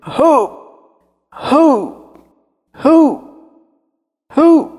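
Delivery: high, loud heave-ho grunts, rising; heaving cries. A narrator's reading. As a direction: strain and lift the millstone with each cry. (gritos altos de esforço)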